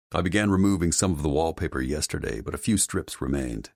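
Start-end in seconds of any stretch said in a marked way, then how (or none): none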